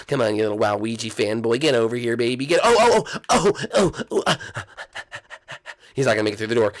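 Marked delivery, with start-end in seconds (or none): 2.5-4.4 excited yelling. Real, not acted. Chasing, yelling at the catch.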